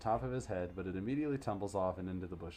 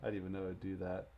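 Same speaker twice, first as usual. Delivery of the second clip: ordinary delivery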